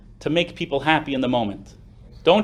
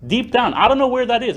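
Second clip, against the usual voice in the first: emotional outburst; 9 semitones higher, 5 dB louder than usual. Retold or imitated. Emotional retold confession.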